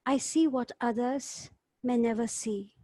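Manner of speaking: ordinary talk, nothing marked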